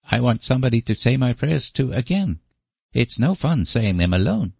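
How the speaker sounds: put-on high, pleading small boy; little boy voice. A narrator's reading. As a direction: voice a small boy who wants company. Davy the small boy speaks.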